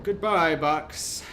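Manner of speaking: loud theatrical goodbye to a box; mock farewell. Bidding the box farewell, hammed-up loud. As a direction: call out a grand farewell.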